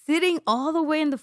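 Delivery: high, loud incredulous question; can't believe where he sat; indignant surprise. Retold or imitated. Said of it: "I'm like".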